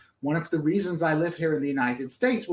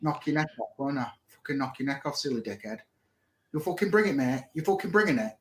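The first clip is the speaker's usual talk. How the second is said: rough-talking Hull local; Hull accent. Shown as a demonstration. Streamer showing how people speak in Hull, threatening Hull accent. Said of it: "That's how people speak in Hull".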